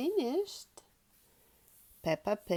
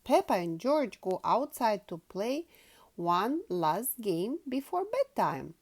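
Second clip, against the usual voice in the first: plain reading voice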